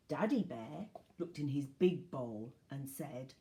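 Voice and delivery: usual speaking voice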